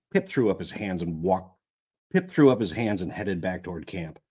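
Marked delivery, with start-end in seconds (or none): none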